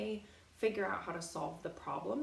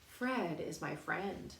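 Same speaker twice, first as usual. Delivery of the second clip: ordinary delivery, nothing marked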